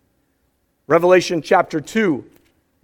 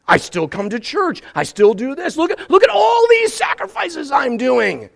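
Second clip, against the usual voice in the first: shocked protest; 5 semitones higher than usual. Pretend. Churchgoer protesting God's rebuke, shocked then pleading. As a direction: act scandalized, then plead your case.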